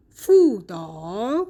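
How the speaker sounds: slow "فودا", "فو" in second tone, "دا" dipping then rising; drawn-out sandhi demo of "فودا"; tone two plus three. Shown as a demonstration.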